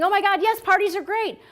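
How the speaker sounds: high, loud mock gushing; overexcited clerk parody; over-the-top excitement. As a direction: gush as if jumping around. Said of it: "don't start jumping around and saying".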